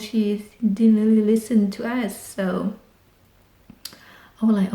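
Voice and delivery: usual speaking voice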